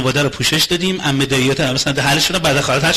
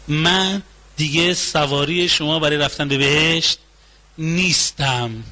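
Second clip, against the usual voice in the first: drawn-out refusal; slower than usual. Acted.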